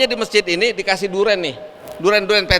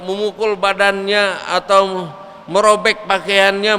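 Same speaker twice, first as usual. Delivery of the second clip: normal talking voice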